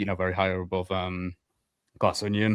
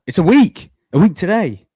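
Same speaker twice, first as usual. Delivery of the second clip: high, loud and hyped; practically shouting with excitement; excited; 9 semitones higher, 8 dB louder than usual. Real, not acted. Hyped about meeting up.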